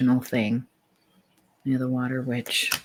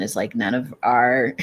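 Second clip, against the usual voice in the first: ordinary delivery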